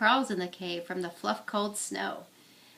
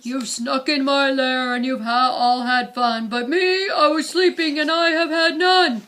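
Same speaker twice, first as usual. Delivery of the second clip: grumpy bear complaint; 3 semitones higher, 9 dB louder than usual. Acted. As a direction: roar your grievance at the guests. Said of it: "he whimpers and he moans".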